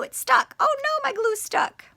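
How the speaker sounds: very high, squeaky mock alarm; playful dismay. Teacher opening glue for young pupils, playful high-pitched dismay. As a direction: squeak out a pretend little panic.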